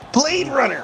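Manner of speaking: high-pitched shouted call of the move; excited move call. Real, not acted. Player calls the wrestling move as it hits, excited call. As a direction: belt out the move name high.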